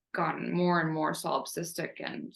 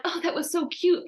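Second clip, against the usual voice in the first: mock cooing; 10 semitones higher than usual. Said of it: "I did not feel like".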